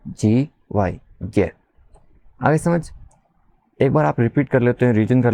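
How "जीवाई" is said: ordinary talk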